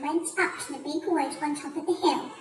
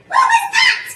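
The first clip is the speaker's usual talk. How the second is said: very high, loud yelp; startled cry; 9 dB louder than usual. A narrator's reading. Missus Twit feeling something on her head, startled cry. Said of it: "cried Missus Twit".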